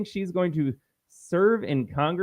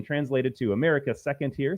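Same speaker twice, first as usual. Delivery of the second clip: ordinary talk, nothing marked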